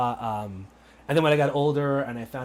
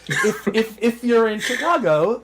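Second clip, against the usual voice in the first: real laughter; 7 semitones higher, 7 dB louder than usual. Real, not acted. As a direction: keep laughing as you talk. Amused by the Boston remark.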